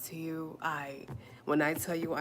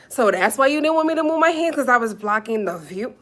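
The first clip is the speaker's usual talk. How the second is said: high, loud incredulous comeback; indignant disbelief; 7 semitones higher, 12 dB louder than usual. Real, not acted. Streamer answering complaint about blocked view, indignant. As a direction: throw the complaint back, loudly outraged.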